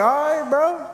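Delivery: high exasperated all right bro; squeaky incredulous agreement. Retold or imitated. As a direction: pitch way up in disbelief. Exasperated reply.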